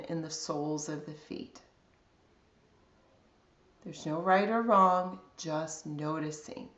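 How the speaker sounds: calm, unhurried body scan guidance with long pauses; guided meditation. Real, not acted.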